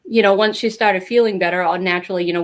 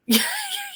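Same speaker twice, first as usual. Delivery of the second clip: incredulous laugh; 6 dB quieter than usual. Real, not acted. Laughs at the doctor's claim.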